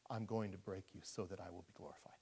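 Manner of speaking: hushed whispered voice of God. Acted. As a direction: whisper it like a secret. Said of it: "what God is saying to you is".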